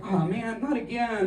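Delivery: a tired clerk who has seen it all; weary clerk. Retold or imitated. Kinko's worker printing the profile, high and weary. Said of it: "he's just like".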